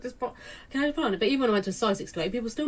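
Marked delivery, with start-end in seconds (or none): 0.0-1.2 mock exasperated plea. Mock exasperated quote to people.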